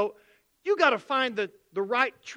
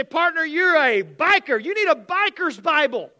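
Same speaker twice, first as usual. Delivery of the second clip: biker-style clerk; 7 semitones higher, 9 dB louder than usual. Retold or imitated. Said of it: "they say".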